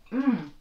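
savoring mmm. Real, not acted. Tasting cookie, mmm sound.

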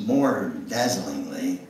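normal talking voice

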